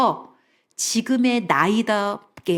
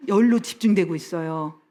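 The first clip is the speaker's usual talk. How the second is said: ordinary talk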